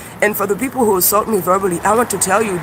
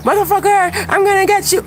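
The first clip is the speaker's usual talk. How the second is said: yelling back at a YouTube troll; mock threat; 12 semitones higher than usual. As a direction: play the crazy commenter screaming back. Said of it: "I start saying crazy shit to you".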